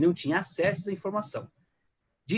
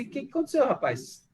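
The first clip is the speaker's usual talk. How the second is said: high-pitched opening, falling away on the last word; lightly acted surprised question; concerned questioning king; 7 semitones higher than usual. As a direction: act out a king asking what happened. Acts the king questioning Nehemiah.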